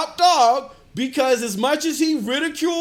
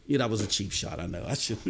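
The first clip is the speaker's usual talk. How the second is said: low, soft words breaking into a laugh; chuckling admission; 7 dB quieter, faster than usual. Real, not acted. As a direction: own up to the jab, chuckling under it. Chuckling at own cheap shot.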